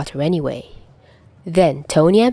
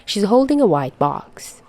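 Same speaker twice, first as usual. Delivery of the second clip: ordinary delivery, nothing marked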